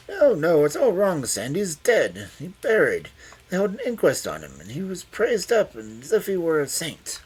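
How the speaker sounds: put-on high, loud agitated old man; agitated protest. A narrator's reading. Confused master insisting Sandy is dead, agitated.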